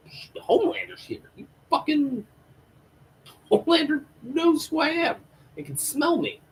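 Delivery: very high, strained, squeaky fright; Huey in mock panic. Disguised man spotting Homelander at the party, mock panic. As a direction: imitate a terrified man trying to stay unnoticed.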